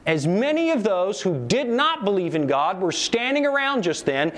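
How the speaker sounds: normal talking voice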